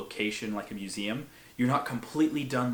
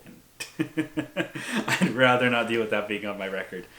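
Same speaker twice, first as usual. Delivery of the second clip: real laugh running into the words, louder; 5 dB louder than usual. Real, not acted. Declining to risk it on his record, laughing. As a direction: say it through a chuckle.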